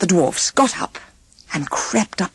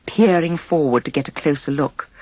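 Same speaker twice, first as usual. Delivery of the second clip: usual speaking voice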